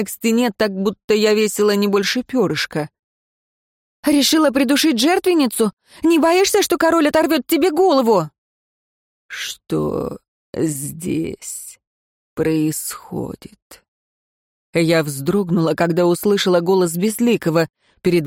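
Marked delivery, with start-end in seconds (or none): 4.0-8.3 defiant taunt. Acted. Choked heroine defiant. 9.3-13.8 low, soft and very slow; measured authority from the doorway; quiet, commanding stranger; cold master voice. Acted. Voice of Безликий enters.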